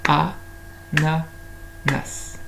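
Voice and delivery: "A, na, nas" evenly spaced, one beat each; "A, na, nas" counted in separate beats; beating out syllables. Shown as a demonstration. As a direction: tap out "A, na, nas" with gaps between. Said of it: "on peut les frapper".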